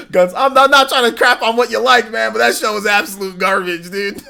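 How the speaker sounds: loud, high laughing rant. Real, not acted. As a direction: shout it out through laughter. Laughing rant at chat viewer.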